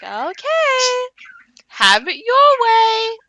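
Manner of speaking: high, drawn-out little lady voice; Three. Retold or imitated. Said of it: "And Three is like".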